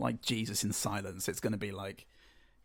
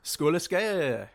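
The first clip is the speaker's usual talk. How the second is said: quoted toast; 6 semitones higher than usual. Retold or imitated. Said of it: "And then they say".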